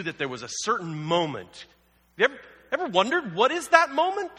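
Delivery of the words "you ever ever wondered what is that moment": excited question. Real, not acted. Rhetorical question to congregation.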